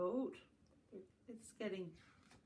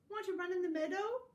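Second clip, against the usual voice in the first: high, loud, quick invitation; high-pitched rushed sheep question; storybook sheep; 11 semitones higher, 5 dB louder, faster than usual. Acted. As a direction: ask eagerly in a high sheep voice. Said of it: "bleated the sheep".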